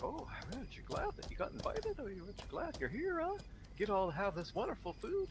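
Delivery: high, patronizing coo; condescending host. Retold or imitated. Host patronizing an invited guest, high and cooing. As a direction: talk down to a charity case.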